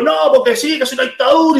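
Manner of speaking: high-pitched mock ranting; increasingly agitated. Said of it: "El tipo se pone peor, se pone peor".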